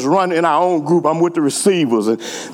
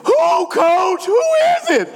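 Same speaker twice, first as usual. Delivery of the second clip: calling out. Retold or imitated. Player shouting to the coach asking who slacked. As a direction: shout it across the field.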